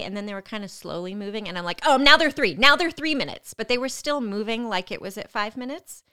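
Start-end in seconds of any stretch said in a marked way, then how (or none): 1.8-3.5 loud, higher, urgent update; insistent alert. Retold or imitated. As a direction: raise the voice to make staff take notice. Insisting contractions are closer.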